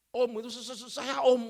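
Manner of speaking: mock stammer, catching on se-; imitating a stutter. Pastor imitating his stuttering son. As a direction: play the twin tripping over his words. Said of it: "yang kembar itu gagap".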